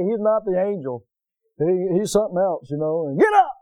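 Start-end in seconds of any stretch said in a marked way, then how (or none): 3.2-3.5 loud, much higher bark of get up; impatient dad yelling; dad's shout. Retold or imitated. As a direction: yell it like a stern father. Dad voiced again, shouting.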